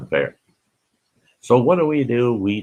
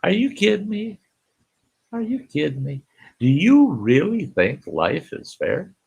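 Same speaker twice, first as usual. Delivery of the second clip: mock disbelief; 9 semitones higher than usual. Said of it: "I just like".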